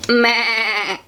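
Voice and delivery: wavering storybook bleat; goat bleat. A narrator's reading. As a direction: bleat like a goat for a small child. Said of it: "says the goat".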